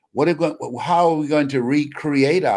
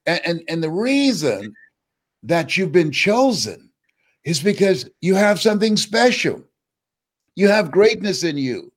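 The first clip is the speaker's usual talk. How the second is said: rising sermon; 4 semitones higher than usual. Acted. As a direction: lift them up like a preacher. Preaches to the audience.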